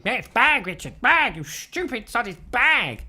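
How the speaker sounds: high, loud mock yell of the crowd; mock chorus shouting. Viewers shouting at the host over the word bag. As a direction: bark it at him like an exasperated audience. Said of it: "Everyone's shouting".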